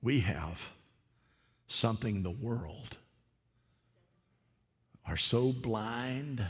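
ordinary talk, nothing marked

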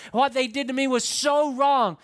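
mock-aggrieved outcry; acted indignant protest. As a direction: insist on how badly you were wronged. Voicing a protesting church member.

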